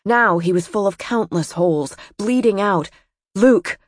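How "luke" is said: raised, higher call of a name. A narrator's reading. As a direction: call sharply to wake him. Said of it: "The shouting tugged at him".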